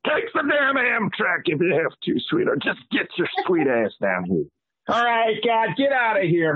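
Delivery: put-on high, twangy good-old-boy cowboy; cowboy character voice.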